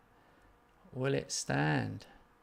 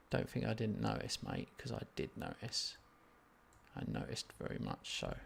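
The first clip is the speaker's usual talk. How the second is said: muttered; 13 semitones lower, 7 dB quieter than usual. Real, not acted. Scolding keeper, muttering.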